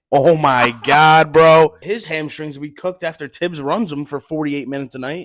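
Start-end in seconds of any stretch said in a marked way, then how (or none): 0.1-1.8 slow, stretched, loud disbelief; exasperated groan. Real, not acted. Host reacting to the Knicks' Towns trade, stretched loud disbelief. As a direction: drag out the disbelief.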